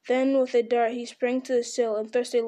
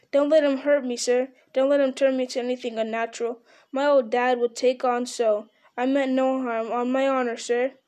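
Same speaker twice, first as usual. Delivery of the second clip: usual speaking voice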